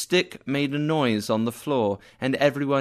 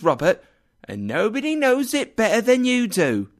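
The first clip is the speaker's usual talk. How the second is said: indignant; 6 semitones higher than usual. Acted. Wife insists Scrooge is mean.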